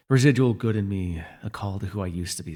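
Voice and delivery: ordinary talk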